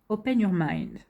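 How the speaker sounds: English with a thick French accent; French-flavoured attempt at an English accent; mock-proud English accent. Real, not acted. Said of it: "Vous avez vu mon super abs-, accent anglais".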